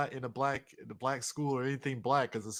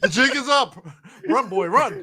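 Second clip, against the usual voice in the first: higher, louder urgent call; loud mock alarm; 10 semitones higher, 9 dB louder than usual. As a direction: yell the warning dramatically.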